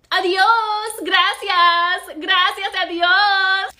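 loud, high, drawn-out farewell; mock-cheery send-off. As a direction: sing out a hammed-up goodbye.

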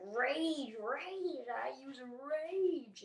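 high, loud, stretched-out Pokemon roar; hammed-up attack call; mock battle cry. Acting Pokemon using its attack.

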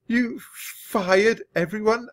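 high, slow, stunned stammer. A narrator's reading. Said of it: "I stammered".

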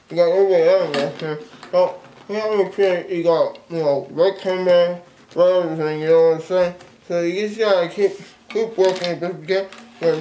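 muffled through full mouth; talking around a mouthful of gum; mouth stuffed with gumballs. Real, not acted. Seen 2: Cheeks bulge; gumballs visible between lips; keeps adding more from tube.

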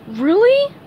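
high, loud delighted question; hopeful Patrick. A narrator's reading. Patrick is offered his friend's eggs, hopeful. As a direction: ask it high with a smile. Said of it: "Patrick smiled".

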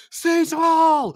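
high, loud call across the house; screaming a name. Retold or imitated. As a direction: yell for Cecil like he's far away. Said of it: "Like, screaming to Cecil".

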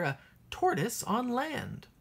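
usual speaking voice

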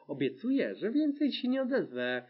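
mildly tearful, rising high after the first word. A narrator's reading. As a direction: promise meekly, a touch of tears. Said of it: "odpowiedziała przez łzy". (lekko płaczliwie, przez łzy)

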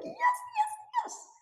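very high squealed yes yes yes; ecstatic yes. Shown as a demonstration. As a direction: squeal it out in pure bliss. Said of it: "I just, I'm like".

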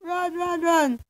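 high urgent calls to run. Real, not acted. Player urging someone to run away, urgent.

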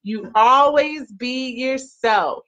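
normal talking voice

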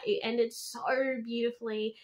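ordinary talk

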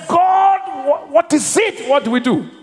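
retelling the plea as he once cried it; desperate cry to God. Retold or imitated. Retold desperate prayer to God.

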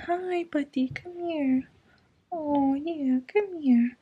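high, soft coaxing coo; pet talk. Real, not acted. Calling her cat Zeus over, high soft coo.

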